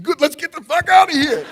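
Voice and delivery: high loud laughing relief; relieved outburst. Retold or imitated. To Sue after she lets him off, a high loud laughing relief. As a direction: blurt out the escape with a laugh.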